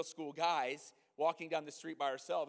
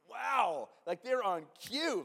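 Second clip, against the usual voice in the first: amazed wow; 5 semitones higher, 7 dB louder, much slower than usual. Retold or imitated. Amazed at the boys' answer.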